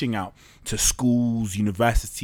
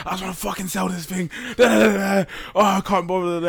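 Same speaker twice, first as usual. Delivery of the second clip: higher, fed-up mock whine; a founder venting startup frustration in a higher whiny voice; exasperated; 9 semitones higher, 4 dB louder than usual. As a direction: throw your hands up, pitched up and over it. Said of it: "And sometimes I'm like".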